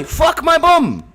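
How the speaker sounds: put-on high-pitched comic Australian accent. Interrupting co-host's apology, high comic Australian accent. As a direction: yelp it in cartoon Australian. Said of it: "My Australian and my, my, my Scottish are the same".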